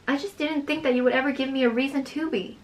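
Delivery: ordinary delivery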